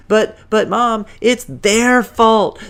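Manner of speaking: put-on high, loud whine of a blamed kid; whiny protest. Billy blames his friends to Mom, whiny protest. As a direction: whine loudly, shift the blame.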